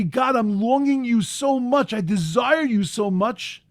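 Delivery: a voice reaching up in longing; longing prayer. Acted. Preacher voicing a yearning prayer to God, slow and pleading. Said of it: "tears that say".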